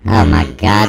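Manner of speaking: ordinary talk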